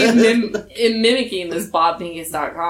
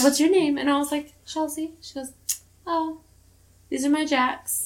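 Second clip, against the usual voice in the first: put-on little girl voice; 8 semitones higher, 5 dB quieter than usual. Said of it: "she's like".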